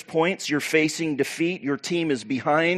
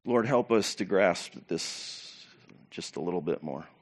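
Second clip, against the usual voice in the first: low, soft, earnest plea; hushed prayer; 7 semitones lower, 8 dB quieter than usual. Real, not acted. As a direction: drop to a quiet prayer. Brief prayer to God mid-sermon.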